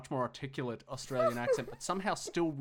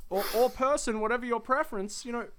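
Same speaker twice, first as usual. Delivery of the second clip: much higher, louder mock fatherly advice; a future father lecturing his son, hammed up; future dad voice; 11 semitones higher, 12 dB louder than usual. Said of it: "I will be like".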